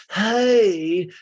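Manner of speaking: high, loud drawn-out greeting; hammed-up hello, swooping high; [name]. [name] greets Greg the owner, hammed-up hello.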